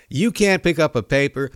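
ordinary talk, nothing marked

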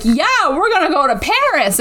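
high excited announcement. Retold or imitated. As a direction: pitch it bright and eager. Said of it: "And she's like".

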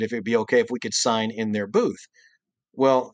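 usual speaking voice

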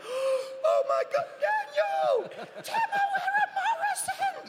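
high, loud and stretched, starstruck; excited fan shriek. Retold or imitated. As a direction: squeal it like a fan who spots a star. Fan spotting the star father.

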